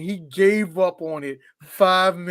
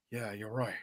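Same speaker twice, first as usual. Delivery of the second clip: mumbled, much softer and lower Cage voice; a lazy half-hearted Cage, barely trying; Nicolas Cage impression; 7 semitones lower, 4 dB quieter, much faster than usual. Mocking Cage's bad accent to friends, lightly mumbled. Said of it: "Nicolas Cage over there".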